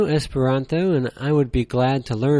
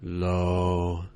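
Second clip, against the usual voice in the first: "low," held deep, lower and flat; low level tone; 6 semitones lower, 4 dB quieter than usual. Shown as a demonstration. Teacher showing learners the third tone, low and flat. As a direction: drop "low," to the bottom and hold it. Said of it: "Same as the first".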